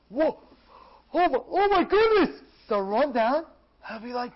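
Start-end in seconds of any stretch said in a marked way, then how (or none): 0.1-2.4 high, loud astonished outburst; acted wonderstruck cry; awestruck amazement. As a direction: exclaim as if overwhelmed by the sight. Acts out seeing the heavenly reward.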